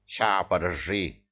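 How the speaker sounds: slow mumble through a mouthful; chewing while talking; mouth full. A narrator's reading. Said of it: "прошамкал ответ Бессони".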